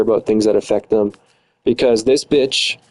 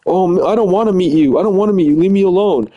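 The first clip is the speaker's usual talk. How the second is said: mocking ex; 6 semitones higher than usual. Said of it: "The day we're supposed to meet up".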